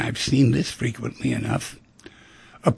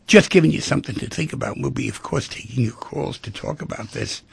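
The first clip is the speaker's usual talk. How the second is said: usual speaking voice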